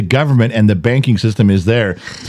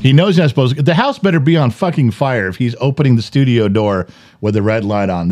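normal talking voice

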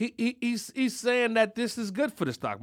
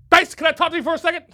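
fast, higher, louder boss voice; mock boss call; 10 semitones higher, 10 dB louder, much faster than usual. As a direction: rattle it off like a pushy boss. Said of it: "can I speak to you".

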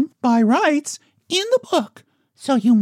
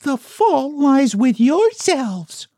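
severely; slower than usual. Acted. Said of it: "said Jane Gladys severely".

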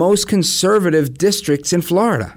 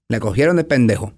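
quick Puerto Rican Spanish quote; Puerto Rican accent; 4 semitones lower, faster than usual. Retold or imitated. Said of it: "My dad would say".

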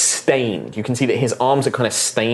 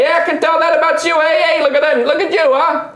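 high, quick, teasing holler; joking halfling; 5 dB louder than usual. Acted. Halfling teases a visitor from far away, high jeering. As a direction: rib the stranger in a high quick voice.

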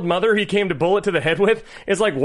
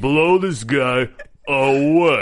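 slow, lower Stallone drawl; 4 semitones lower, much slower than usual. Retold or imitated. Repeating the quote, slow lightly voiced Stallone. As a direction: stretch it out like Stallone. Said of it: "your Stallone".

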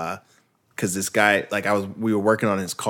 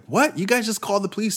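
high, quick disbelief; incredulous; 10 semitones higher, faster than usual. Retold or imitated. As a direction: retell your stunned question, pitched way up. Retold disbelief at his managers.

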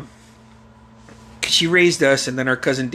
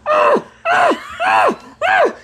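repeated high, loud yelps; imitated muffled distress cries; 5 dB louder, much slower than usual. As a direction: yelp over and over like mom. Mother's cries under the hand.

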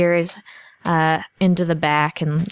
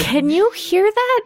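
much higher, slow, uneasy question; eerie high voice; 13 semitones higher than usual. Acted. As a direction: ask it high and spooked. NPC hears player's thoughts.

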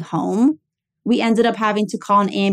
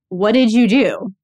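louder, drawn-out teasing reproach; joking mock scold; 3 semitones higher, 5 dB louder, slower than usual. Greeting her sick dad with a joke, mock scolding. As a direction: scold playfully to keep things light. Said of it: "preparing a joke that I would say".

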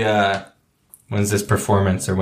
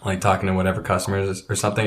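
normal talking voice

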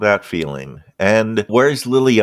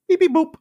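high robotic beep boop; computer beeps; much faster than usual. Acted. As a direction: chirp it like a ship computer. Ship computer chirps.

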